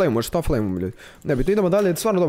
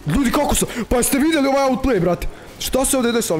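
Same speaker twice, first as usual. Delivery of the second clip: excited; 9 semitones higher than usual. Real, not acted. Hyping his own outplay to viewers.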